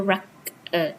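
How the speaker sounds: "record" in two parts, REC... ord; first-syllable stress. Shown as a demonstration. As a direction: split "record", leaning on the opening REC. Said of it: "Starting stress from here".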